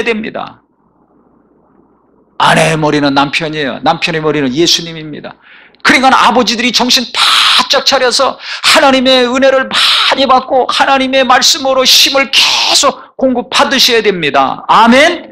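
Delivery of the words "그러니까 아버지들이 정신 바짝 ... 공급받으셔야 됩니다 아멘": higher, building pulpit cry; mounting sermon fervour; preaching at full force. Acted. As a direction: climb toward the altar call. Exhorting fathers.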